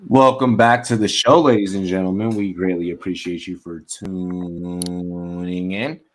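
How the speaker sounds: big, loud announcer intro with stretched words; show announcer. Acted. Host welcoming listeners back and plugging an app, announcer voice.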